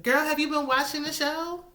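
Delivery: high-pitched sassy disbelief; incredulous challenge. Pretend. Viewer challenging the star on the show's facts, incredulous. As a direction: call her out, pitch way up.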